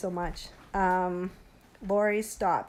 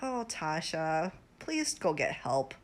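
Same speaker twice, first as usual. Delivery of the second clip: slow, softer and lower, a sincere plea; heartfelt plea; 6 semitones lower, 5 dB quieter, slower than usual. Real, not acted. Streamer softly pleading with Tasha to get help. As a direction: beg her gently from the heart. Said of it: "This is with all my heart saying".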